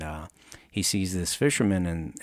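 usual speaking voice

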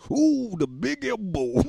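high, slow, hammed-up commentator ooh; wrestling hype. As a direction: call it like a wrestling announcer.

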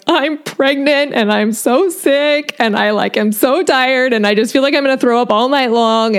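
half-cry it out as if breaking down; retold tearful plea. To her nursing professor, a retold tearful confession. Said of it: "broke down in tears and was like".

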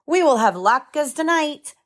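high, excited storybook cry; a read-aloud father calling out happily, mildly; joyful shout. Acted. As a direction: announce it with glee. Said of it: "shouted Papa".

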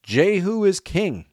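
high, drawn-out crowd cheer; shouted proclamation. A narrator's reading. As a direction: proclaim it slowly in a high, ringing voice. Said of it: "they shouted".

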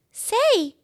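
very high little mouse voice; small mouse squeak, eager; squeaky mouse. A narrator's reading. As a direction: pipe up like a tiny mouse. Ralph the mouse speaks.

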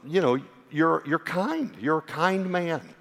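a hint of a wife tentatively offering praise; wife searching for reasons. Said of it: "she's trying to come up with reasons".

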